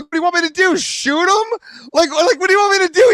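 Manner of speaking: high-pitched incredulous questioning; exasperated protest. Retold or imitated. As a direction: re-enact protesting to onlookers. Protesting to the onlookers.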